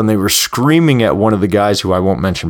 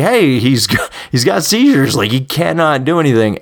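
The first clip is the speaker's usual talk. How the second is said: mock protest yell, pitched up; imitated yelling back; 6 semitones higher than usual. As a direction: act out yelling back without real volume. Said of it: "we're all yelling back like".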